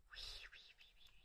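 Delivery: breathy whisper to the dog; soft hushed reassurance. Real, not acted. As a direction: soothe her in a whisper.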